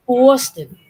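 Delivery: Boston as "BAW-ston", loud rounded vowel; mocked Boston accent. Mimicking people who make fun of the accent, Boston as "BAW-ston". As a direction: overdo the first vowel of Boston into "aw". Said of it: "make in fun of the Boston accent".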